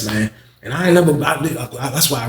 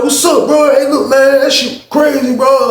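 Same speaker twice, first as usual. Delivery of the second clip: high loud greeting; 12 semitones higher, 4 dB louder, slower than usual. Retold or imitated. Talking from cell.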